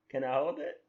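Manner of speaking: ordinary delivery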